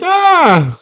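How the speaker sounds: very high mock scream; sudden high-pitched shriek; frightened scream. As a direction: imitate a girl shrieking at a snake. Scarf turns into snake on her.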